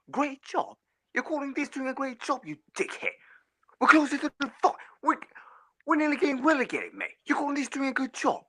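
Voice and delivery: high, incredulous angry yelling; outraged disbelief. Real, not acted. Fan rages at the board over praise for Arteta, incredulous. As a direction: throw his words back at him, appalled.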